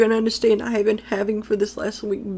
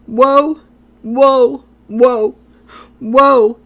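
louder, ringing woe calls; loud cries of woe; 4 semitones higher, 8 dB louder, much slower than usual. Acted. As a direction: cry it out like a doom herald. Prophet crying woe to earth.

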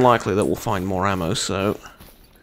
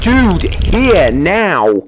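loud high call to a teammate; urgent shout; 7 semitones higher, 6 dB louder, much slower than usual. Real, not acted. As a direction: yell for him to get over here now. Calling teammate to come.

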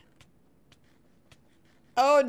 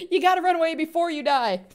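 urgent call; 7 semitones higher, 5 dB louder than usual. Real, not acted. Urging a fellow player.

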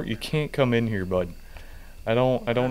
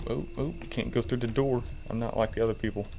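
ordinary talk, nothing marked

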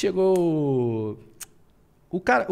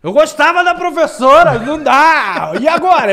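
dramatic protest; 9 semitones higher, 10 dB louder than usual. Retold or imitated.

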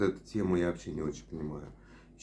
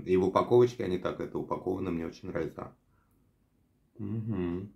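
tomato held at lips; chewing between words; talking while eating. Real, not acted. Seen 1: Holds tomato piece at lips, biting and chewing while talking.

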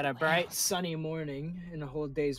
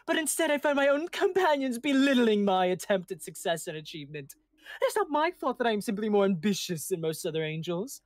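wounded pompous angel; 5 semitones higher, 6 dB louder than usual. Acted. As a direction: play the offended proud angel. NPC speaks with wounded pride.

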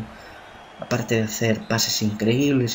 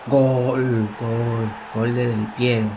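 breakneck goal commentary; much slower than usual. Pretend. Calls out his goal like commentator.

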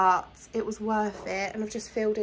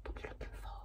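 whisper; 19 dB quieter, much faster than usual. Real, not acted. Mum whispering to the curious baby, hushed. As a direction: breathe the question gently, no voice.

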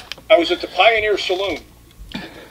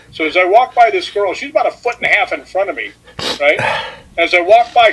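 usual speaking voice

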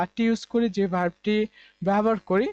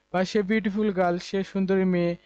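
normal talking voice

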